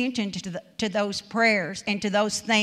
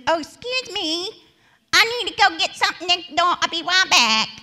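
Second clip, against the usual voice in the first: high, louder chirpy voice of the salon worker, laughing at the end; nail salon woman; 10 semitones higher, 5 dB louder than usual. Retold or imitated. Preacher imitating the nail salon worker excusing herself, high and chirpy. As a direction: lift way up and chirp out a quick excuse to leave. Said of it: "that's the way they talk".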